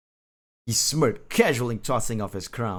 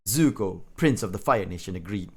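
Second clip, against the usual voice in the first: plain reading voice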